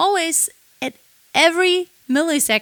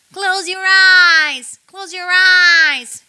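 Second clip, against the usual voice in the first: louder, higher, slow with a bit of twang; a touch of twang; 5 semitones higher, 7 dB louder, much slower than usual. Shown as a demonstration. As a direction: lift the back of the throat for ping. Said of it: "tiniest bit of".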